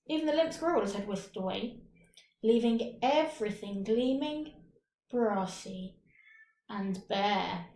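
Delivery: usual speaking voice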